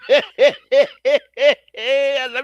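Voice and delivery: a big burst of laughter; loud laugh. Real, not acted. Laughs at own joke.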